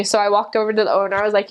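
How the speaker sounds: ordinary talk, nothing marked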